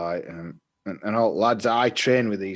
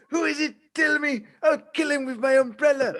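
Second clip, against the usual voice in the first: mock angry teacher; 6 dB louder than usual. Voicing the angry teacher, laughing.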